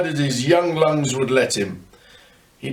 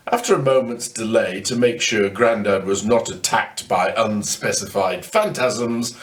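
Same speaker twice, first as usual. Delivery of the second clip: plain reading voice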